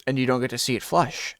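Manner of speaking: ordinary talk, nothing marked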